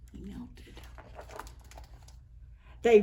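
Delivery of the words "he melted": whispered. A narrator's reading. Melting described.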